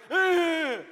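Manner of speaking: loud, high grunt of disgust; baby's ugh. Retold or imitated. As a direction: imitate a baby's fed-up grunt. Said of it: "and he goes".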